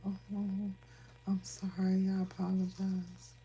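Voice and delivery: high, slow sarcastic apology; sarcastic fake-sorry voice; mock apology. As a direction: sweeten it sarcastically. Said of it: "You want me to be like".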